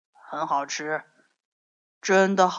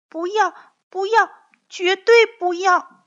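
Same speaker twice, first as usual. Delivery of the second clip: put-on high, loud child's refusal; protesting child; 13 semitones higher, 8 dB louder than usual. A narrator's reading. Young dinosaur refusing to leave father, high loud protest. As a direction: cry out in a high little kid voice.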